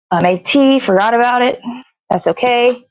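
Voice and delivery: normal talking voice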